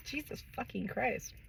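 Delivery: weary exclamation. Real, not acted.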